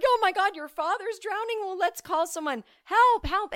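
high, hammed-up cries for help; mock panic. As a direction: yelp in mock alarm as if someone is drowning. Panics about father drowning.

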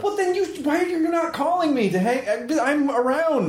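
high-pitched, wounded complaint; mock-hurt protest. Host asks why friend never calls, high mock-hurt protest. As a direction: whine it way up high, playfully.

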